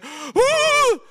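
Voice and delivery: high, loud mock scream; sudden high-pitched cry of alarm; panicked wake-up cry. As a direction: wake up with a startled yell. Retelling someone waking up in panic.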